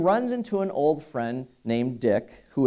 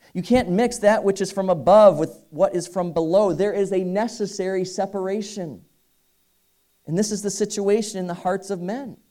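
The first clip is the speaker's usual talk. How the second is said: higher, urgent preaching voice; fervent preaching; 7 semitones higher than usual. Acted. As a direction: press the point home to the congregation. Preacher raising pitch to exhort.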